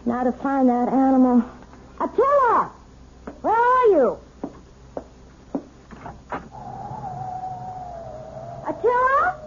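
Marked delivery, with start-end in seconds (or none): 2.0-9.4 calling the dog. Acted.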